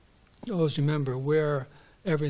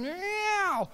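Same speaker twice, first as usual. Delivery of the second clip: high yowling meow; a frightened cat's cry. Acted. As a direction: wail like a chased cat. Cat bursts in.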